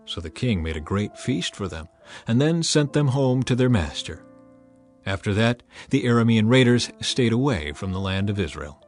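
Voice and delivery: plain reading voice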